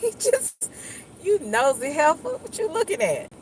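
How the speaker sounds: high-pitched acted affront at a starer; mock indignant. Snapping at someone caught staring, high mock indignation. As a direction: scold a nosy onlooker in a high voice. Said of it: "they be like".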